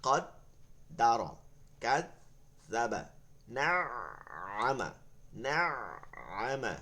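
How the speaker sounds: কদ্দারা and নাআমা read slowly with long held vowels; slow Quran reading. Shown as a demonstration. Teacher slowly reading কাযযাবা and নাআমা to students. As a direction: stretch each word slowly, syllable by syllable. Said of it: "দাদা".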